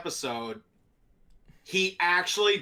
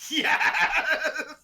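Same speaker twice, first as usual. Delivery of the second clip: high, whooped exclamation with laughter; imitated gleeful cheer; 12 semitones higher, 3 dB louder than usual. Retelling the actor's reaction to the story, imitated gleeful whoop. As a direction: whoop it as the delighted listener did. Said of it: "he just went".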